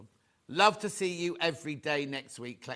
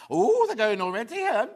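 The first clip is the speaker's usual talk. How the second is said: "Oh" rising, louder and higher, ending on a high Helen; hammed-up surprise; 7 semitones higher, 7 dB louder than usual. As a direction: play up the shock that they're selling fast. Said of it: "I don't know why I said it like that".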